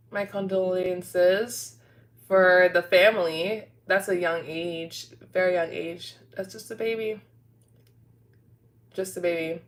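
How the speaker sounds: quiet, low and sorrowful; soft condolences. Real, not acted. Streamer consoles a viewer who lost a friend, soft and sorrowful. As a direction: console gently, with long pauses between thoughts.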